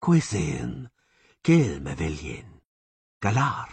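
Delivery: sonorous command. A narrator's reading. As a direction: command them to halt.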